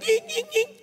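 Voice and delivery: very high-pitched little dog whines; imitated dog whimper. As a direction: squeal like a scared small dog, in short bursts. (tiruan rengekan anjing ketakutan)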